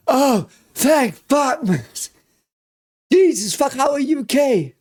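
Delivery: relieved crying shriek. Real, not acted. Fan seeing a beloved character alive, tearful shriek. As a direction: squeal it out half sobbing. Character survives after all.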